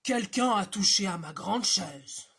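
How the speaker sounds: big bear shouting about his chair. Acted. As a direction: roar it like a huge papa bear. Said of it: "cria d'une grande voix".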